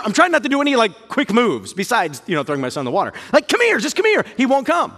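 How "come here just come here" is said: high, tight coaxing call; tense high coaxing. Retold or imitated. Father calling his son away from the snake, tense high coax. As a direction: keep it light but urgent, pitched up.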